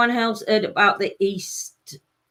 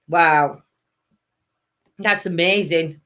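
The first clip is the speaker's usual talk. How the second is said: ordinary delivery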